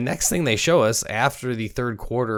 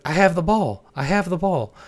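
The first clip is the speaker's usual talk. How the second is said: higher, mock-insistent I have the ball; cocky retort; 7 semitones higher than usual. Robinson answering Littleton's taunt, higher mock-insistent. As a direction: rub it in, voice pitched up. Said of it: "He's like".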